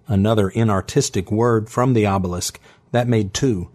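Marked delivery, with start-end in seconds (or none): none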